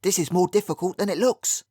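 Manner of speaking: tight, pinched upper-class vowels; a hint of a strained society accent; strangulated posh vowels. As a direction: squeeze the vowels like a society girl. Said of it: "Letty Young's strangulated vowels".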